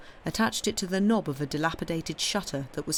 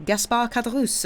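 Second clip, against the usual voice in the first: higher-pitched, lightly voiced innkeeper; innkeeper introducing himself; 7 semitones higher, 4 dB louder than usual. A narrator's reading. Innkeeper confirming his name to the stranger, eager to serve. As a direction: announce your own name as at your service.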